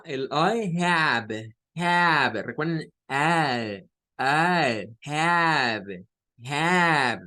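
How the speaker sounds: "I have" drawn out slowly, H heard and final E silent; "have" with a clear H, slow and careful; slow demonstration. Shown as a demonstration. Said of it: "en esa A". ("I have" lento, con H clara y sin E final)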